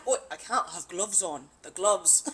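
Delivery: put-on Australian accent; mock Aussie excuse; Tynan. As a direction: imitate an Australian friend explaining himself. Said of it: "He's like".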